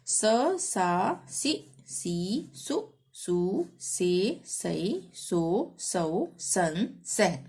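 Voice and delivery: measured sing-song letter chanting; syllable drill recitation. Shown as a demonstration. Teacher reciting Hindi syllables for learners, sing-song drill. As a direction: recite each syllable separately with a lilt.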